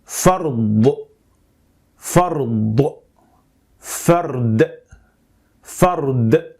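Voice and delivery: "fard" ending in emphatic ḍād, slow; slow clear dictionary pronunciation. Shown as a demonstration. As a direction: say "fard" as Arabic farḍ, deliberate. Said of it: "practice to get it right".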